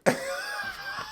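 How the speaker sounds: very high squealing laugh; laughing at own impression; shrieking laugh. Real, not acted. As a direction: crack up uncontrollably. Cracks up.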